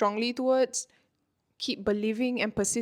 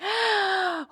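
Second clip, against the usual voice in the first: mock gasp; 10 semitones higher than usual. Ego voiced mockingly.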